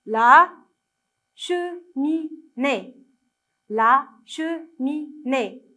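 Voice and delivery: "cheminée" very slow, che, mi, née separated; syllable-by-syllable reading. Shown as a demonstration. Teacher helping children try reading, "cheminée" slowly split into syllables. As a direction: sound out "La cheminée" with a gap after each syllable. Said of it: "Lisez avec moi, essayez de lire".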